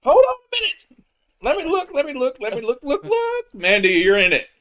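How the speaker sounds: high-pitched excited yelling; squealing with excitement. Real, not acted. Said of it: "as he loses the ability to speak out of sheer excitement".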